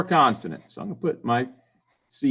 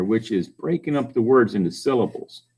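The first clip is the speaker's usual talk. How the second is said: ordinary talk, nothing marked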